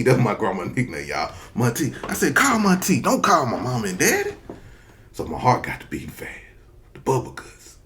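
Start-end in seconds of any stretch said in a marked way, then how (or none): 2.3-4.3 pleading. Retold or imitated. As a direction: beg them not to call. Begging again.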